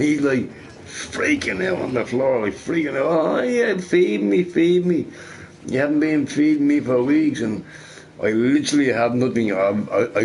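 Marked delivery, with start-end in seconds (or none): 3.1-7.4 much higher whiny dog begging to be fed; starving dog voice. Retold or imitated. Dream dog begging its owner for food, high whiny plea. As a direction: pitch way up as the hungry dog pleading.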